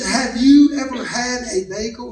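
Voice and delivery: "Have you ever had a bagel" with every word separate; enunciating wife. Retold or imitated. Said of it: "She enunciates, and I love it".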